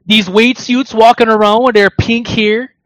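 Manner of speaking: high, loud put-on Toronto slang voice; mock Toronto youth accent. Podcaster mocking Toronto zoomers for friends, in a mock Toronto youth accent.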